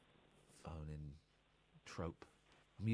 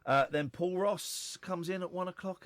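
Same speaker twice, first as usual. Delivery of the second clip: normal talking voice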